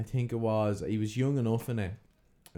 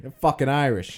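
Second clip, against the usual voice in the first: loud, higher Boston Irish; Boston Irish accent; 5 semitones higher, 8 dB louder than usual. Pretend. Friend insisting the ancestors are Irish, loud Boston Irish accent. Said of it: "the Boston Irish one's funny as well".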